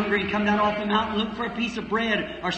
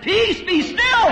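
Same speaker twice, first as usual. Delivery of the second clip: slow, high booming command; commanding shout; 12 semitones higher, 5 dB louder, slower than usual. Acted. Preacher shouting Jesus' words to the stormy sea. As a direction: still the storm with a great cry.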